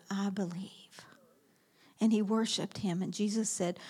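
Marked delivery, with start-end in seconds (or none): 0.1-1.1 whispered, much softer; hushed confession. Acted. Healed blind man confessing faith to Jesus, soft whisper. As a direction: breathe the confession.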